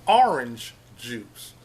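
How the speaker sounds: orange in two syllables, "ar-inj"; "AR-inj juice", carefully sounded; viewer's pronunciation of orange. Retold or imitated. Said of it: "She said it's pronounced".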